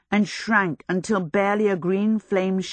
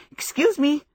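polite call; 9 semitones higher than usual. A narrator's reading. Pushing through a crowd.

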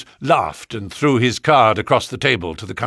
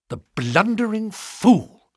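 higher, slow and scornful; bitter; 9 semitones higher than usual. A narrator's reading. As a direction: spit out the insult with bitter scorn. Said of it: "Holmes said bitterly".

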